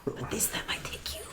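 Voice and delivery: hissed unvoiced monster whisper, low; chimera voice. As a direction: breathe it like a dark chimera. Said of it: "In this terrible chimera voice".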